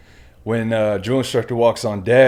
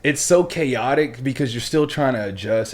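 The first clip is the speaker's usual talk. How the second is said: ordinary delivery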